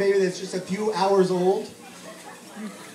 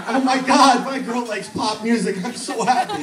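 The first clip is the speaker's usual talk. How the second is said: higher, louder laughing joy; overjoyed laughing; 4 semitones higher, 4 dB louder than usual. Retold or imitated. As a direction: gush with laughing delight. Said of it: "And I went".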